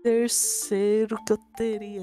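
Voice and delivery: usual speaking voice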